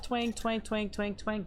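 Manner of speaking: high playful twang noises, one after another. Retold or imitated. Explaining bounces to viewers, high playful twang sounds. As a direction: mimic shells pinging off armor.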